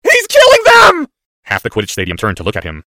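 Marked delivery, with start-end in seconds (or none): 0.0-1.1 very high, drawn-out scream; shriek. Acted. Boy witnessing killings in his mind, shrieking. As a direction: shriek it at full force. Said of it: "the boy who lived shrieked even louder".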